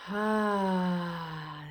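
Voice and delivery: long sighing "ah" exhale; audible out-breath. Shown as a demonstration. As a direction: release the breath on a drawn-out "ah". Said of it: "And out".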